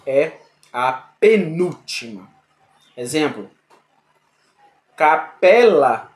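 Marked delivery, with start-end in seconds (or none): 5.0-6.1 capela drawn out slowly and loud; teacher modelling the stressed syllable; slow demo word. Shown as a demonstration. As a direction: stretch out Capela for the class. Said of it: "Vamos fazer essa, a separação silábica".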